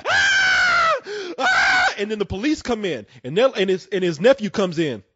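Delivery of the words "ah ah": very high-pitched mock scream; long shrieking wail, played for comedy; imitated scream. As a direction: scream like a man hallucinating. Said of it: "he's, like, screaming".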